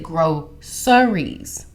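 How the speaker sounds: "groceries" drawn out as GRO-sur-eez. Retold or imitated. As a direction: say "groceries" slowly with a crisp "s" in the middle. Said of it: "Reason why it's spelled G R O C".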